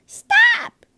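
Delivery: huge shrill scream; yelled. A narrator's reading. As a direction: scream it at the top of her lungs. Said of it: "my sister yelled".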